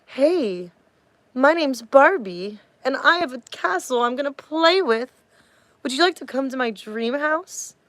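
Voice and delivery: high, sugary doll voice, drawn out; Barbie. Shown as a demonstration. Streamer shows a commenter how tone misleads, high happy doll voice. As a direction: put on a happy, girlish toy-doll voice. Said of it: "what if I was talking like this?".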